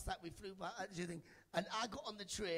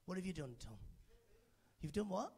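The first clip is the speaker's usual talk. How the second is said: ordinary delivery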